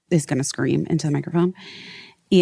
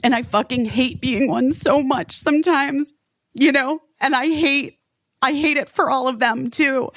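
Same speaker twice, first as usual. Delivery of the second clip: teary; 6 semitones higher than usual. Retold or imitated. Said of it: "got teary and I just was like".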